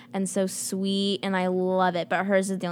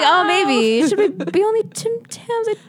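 high, loud mock pondering through laughter; voicing co-host's hesitation; 10 semitones higher, 5 dB louder than usual. Host teasing guest about Tim Tams, high mock hesitation. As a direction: playfully act out her wavering, pitched way up. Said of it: "She's like".

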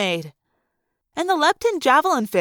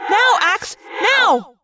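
high, urgent yell; 9 semitones higher, 3 dB louder, much slower than usual. Acted. Rachel yelling the dodge cue to Axe, high urgent. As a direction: shout the cue. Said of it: "I yelled".

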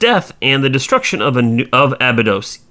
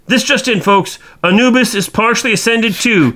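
news bulletin voice; 6 semitones higher than usual. Pretend. Mock breaking news announcement.

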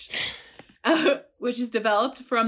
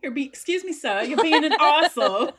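higher, put-on British telling-off; mock British accent; 6 semitones higher, faster than usual. Co-host scolding an imagined sir, mock British.

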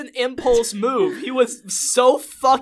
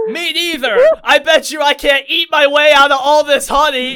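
loud stoner; 6 semitones higher, 9 dB louder than usual. Retold or imitated. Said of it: "He says to Lestrade".

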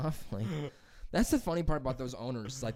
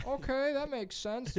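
high, slow, strained polite voice; forced politeness; 14 semitones higher, slower than usual. Retold or imitated. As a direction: fake calm through clenched patience. Forced polite reply, eye twitching.

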